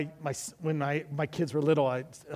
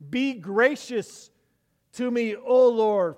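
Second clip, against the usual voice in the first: slow, loud, raised cry to God; pleading; 6 semitones higher, 7 dB louder, slower than usual. Acted. Preacher reading David's prayer for grace, loud slow plea. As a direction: draw out each word as an earnest appeal. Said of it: "David is pleading for God's grace".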